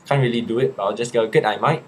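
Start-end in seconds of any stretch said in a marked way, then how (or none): 1.3-1.8 brief go at an Aussie greeting; lightly put-on Australian accent; a hint of an Aussie accent. Shown as a demonstration. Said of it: "Do the best Aussie accent".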